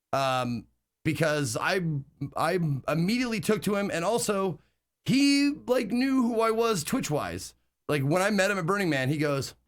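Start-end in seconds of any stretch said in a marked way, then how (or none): none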